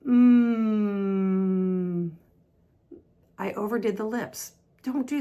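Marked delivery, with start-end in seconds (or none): 0.1-2.1 very long, level "mm"; sustained M hum. Shown as a demonstration. Teacher showing learners the vibration, sustained hum. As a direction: hold a buzzing hum through the nose. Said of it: "you can feel the vibration".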